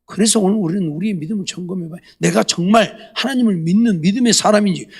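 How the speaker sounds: normal talking voice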